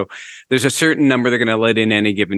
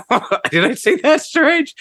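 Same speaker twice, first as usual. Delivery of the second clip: laughing. Retold or imitated. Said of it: "It's like".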